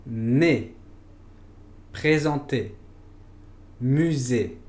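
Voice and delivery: "nez, présenter, musée." each with a tense closed é; French closed é. Shown as a demonstration. Teacher modeling closed é words for learners, careful French. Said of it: "Por ejemplo".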